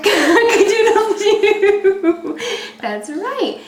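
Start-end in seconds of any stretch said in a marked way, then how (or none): 0.0-2.4 slow, high laughing rooster crow. Pretend. Parent crows like rooster.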